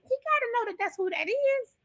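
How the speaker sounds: high, incredulous retold thought; surprised disbelief. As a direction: squeak it out in disbelief. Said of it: "I was kinda surprised".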